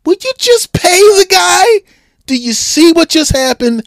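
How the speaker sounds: hammed-up frustrated fan yelling; exasperated yell. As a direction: beg the front office at full volume. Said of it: "I would go".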